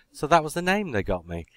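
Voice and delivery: ordinary delivery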